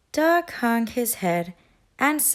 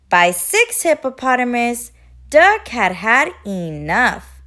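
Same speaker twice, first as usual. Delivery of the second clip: ordinary delivery, nothing marked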